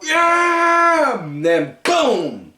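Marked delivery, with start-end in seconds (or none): 0.0-1.2 high, loud "yeah!" like pumping up a crowd; hammed-up battle cry; mock hype yell. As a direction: imitate a wrestler firing himself up. Miz hyping up on the tag.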